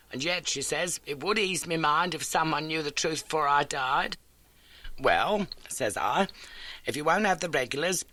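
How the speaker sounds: a lightly voiced elderly landlady; homely, eager old lodging-house keeper. A narrator's reading. Landlady greets Holmes, lightly voiced homely.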